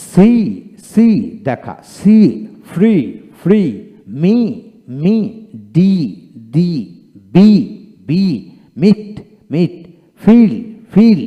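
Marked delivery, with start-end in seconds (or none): 0.1-1.1 "সি" loud and high with a long ee; lengthened ee. Shown as a demonstration. Teacher models for learners how it is pronounced, lengthened ee. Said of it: "কিছুটা দীর্ঘিত উচ্চারিত হবে". 2.7-11.2 "ফ্রি", "মি", "ফিল" loud and high with a long ee; lengthened ee. Shown as a demonstration. Teacher drills learners word by word, lengthened ee. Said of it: "এভাবে উচ্চারণ করবেন".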